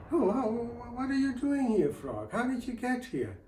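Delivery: shocked father spotting the frog; startled father. Acted. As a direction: jump in surprise at the intruder.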